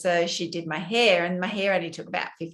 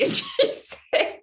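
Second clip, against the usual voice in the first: breaks into a high, breathless laugh mid-line; slower than usual. Real, not acted. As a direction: laugh through the words. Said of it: "it sort of made me laugh".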